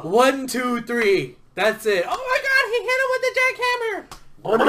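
high, excited commentator call; wrestling commentator. Pretend. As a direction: shout the count, then gasp at the move. Mock referee count and call.

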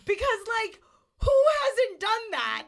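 high, loud rhetorical jab; excited. Real, not acted. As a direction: toss it out excitedly.

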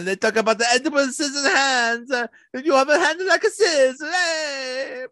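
very high mock-Italian caricature; over-the-top Italian accent. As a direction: play a cartoonish excitable Italian. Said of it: "the over-the-top Italian accent".

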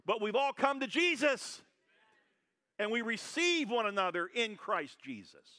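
high, loud celebratory preaching; rally the church with joy; exultant sermon peak; preaching at full force. Acted.

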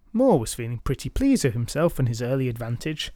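plain reading voice

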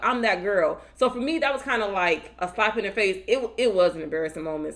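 normal talking voice

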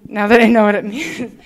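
laughing. Real, not acted. Laughs at her own joke.

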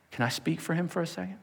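ordinary delivery

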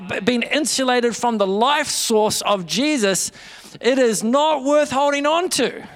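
building sermon. Acted. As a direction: build the point up to a strong finish. Preaching builds to a peak.

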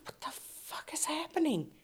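unvoiced, alarmed whisper; a whispered what-the-hell; whispered panic. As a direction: breathe it out in shock. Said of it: "And I'm like".

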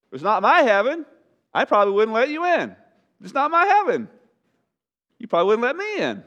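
high, loud acted bickering; mock squabbling. Acts people squabbling over heaven.

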